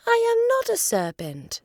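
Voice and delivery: high, outraged denial; indignant Alice. Acted. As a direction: refuse the insult hotly. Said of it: "said Alice indignantly".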